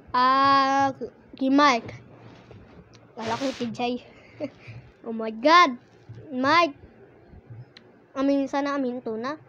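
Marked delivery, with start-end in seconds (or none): none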